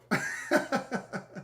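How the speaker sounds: quiet breathy giggle; soft giggle to herself. Real, not acted. Quiet laugh.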